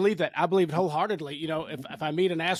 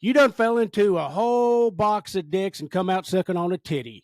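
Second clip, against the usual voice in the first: Kentucky accent; 2 semitones higher, 4 dB louder than usual. Shown as a demonstration. Guest showing off a home-state saying, thick Kentucky accent. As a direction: lay the folksy Kentucky twang on thick. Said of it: "I'm gonna do a better Kentucky accent".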